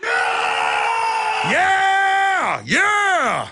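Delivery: crew answering the host's call for a war growl. Real, not acted. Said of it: "Let me hear it".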